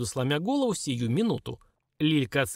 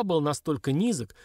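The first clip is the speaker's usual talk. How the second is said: usual speaking voice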